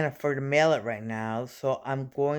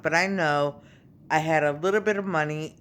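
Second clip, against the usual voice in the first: ordinary delivery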